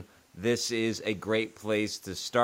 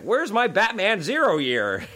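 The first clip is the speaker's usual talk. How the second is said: high, slow, put-upon whine; lightly hammed-up grabby comic owner; mock whiny demand; 8 semitones higher, slower than usual. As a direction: play the petty collector wanting it back. Imagined self demanding comic back.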